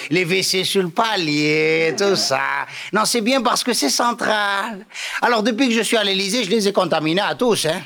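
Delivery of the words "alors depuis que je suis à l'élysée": ordinary talk, nothing marked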